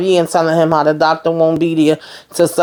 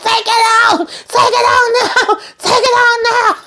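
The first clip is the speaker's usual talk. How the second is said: hysterical panic. Retold or imitated. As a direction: plead in a squeaky laughing frenzy. Sam's panic peaks.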